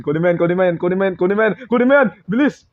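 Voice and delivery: urgent shouting that keeps climbing; building frantic urging. Real, not acted. Urgent repeated command during chase.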